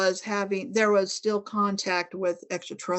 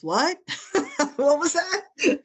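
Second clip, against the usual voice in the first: incredulous inner voice; 7 semitones higher than usual. Retold or imitated. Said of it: "another part of you that goes".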